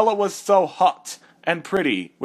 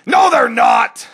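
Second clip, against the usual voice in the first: furious shout; 7 semitones higher, 8 dB louder than usual. Acted. Shouted denial.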